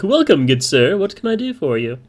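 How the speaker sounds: high, lilting bard greeting; sing-song voice. Acted. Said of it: "in a sing-song voice".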